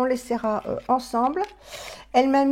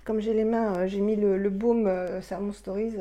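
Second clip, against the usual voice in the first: ordinary talk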